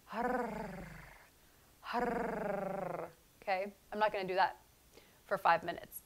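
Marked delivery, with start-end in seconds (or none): 0.0-3.1 harr, harr with a breathy rolled R; rolled R breath. Shown as a demonstration. Teacher demos the trill on an out breath. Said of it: "it's an out breath".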